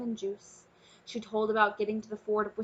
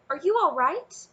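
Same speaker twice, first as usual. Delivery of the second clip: high, louder worried question; anxious excitement; 7 semitones higher, 6 dB louder than usual. Acted. Princess runs to her dragon, anxious and excited. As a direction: rush the question out.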